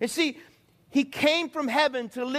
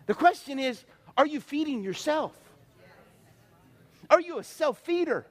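ordinary talk, nothing marked

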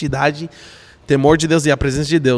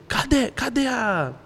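higher, drawn-out searching call; retold searching call; 7 semitones higher, 5 dB quieter, slower than usual. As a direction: slowly call out looking for someone. Retells calling out for the friend.

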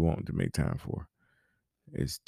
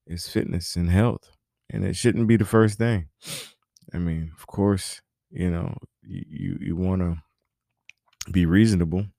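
ordinary talk, nothing marked

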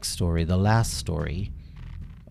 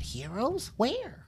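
high, much softer confused question; puzzled child; 8 dB quieter, slower than usual. Acted. Dinky interrupting the king, soft high puzzled. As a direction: ask innocently, looking around.

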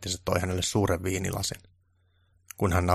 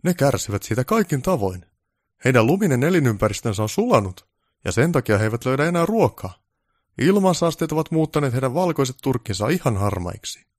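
higher, louder haughty question; self-important director voice; pompous director; 6 semitones higher, 6 dB louder than usual. Acted. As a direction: demand it like a big boss. Director lectures about reindeer.

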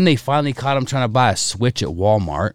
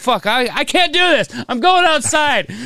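mock whiny exit. Dad giving up on the argument with his family, high whine. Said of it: "And I'm like".

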